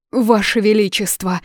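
взволнованный возглас, выше; окликни короля в тревоге; взволнованно. A narrator's reading. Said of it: "ответил взволнованный Олис". (взволнованный слуга)

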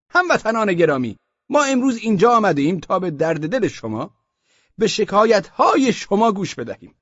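high, loud, pompous official addressing a room; a self-important bureaucrat opening a meeting; Osman Bey's official voice. Acted. Osman Bey greets the merchants in a high, pompous official voice. Speech to low-income crowd.

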